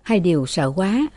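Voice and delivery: ordinary delivery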